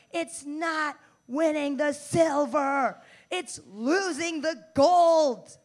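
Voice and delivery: slow, drawn-out mock bellow; imitated coach rant. Coach lectures high school badminton team, overdramatic slow rant. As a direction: stretch out each word like an overdramatic coach. Ranting coach's quote, acted out.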